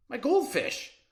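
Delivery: eager high reply; bright answer. Acted. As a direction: pop up high and proud. Kid answers Austin.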